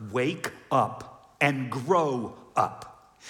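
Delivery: slow, deliberate stern command; slow stern rebuke; stern wake-up call. Retold or imitated. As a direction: spell it out slowly as a rebuke. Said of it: "the Hebrew writer is like".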